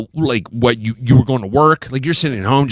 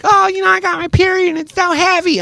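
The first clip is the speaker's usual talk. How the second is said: put-on teen daughter voice; 14 semitones higher than usual. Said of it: "my kids are like".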